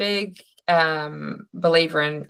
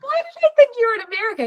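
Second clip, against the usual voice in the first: a much higher, fast, surprised question; much faster than usual. Real, not acted. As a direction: caught off guard, laugh at your own mistake. Realises caller is not in America.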